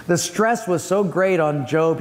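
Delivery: ordinary talk, nothing marked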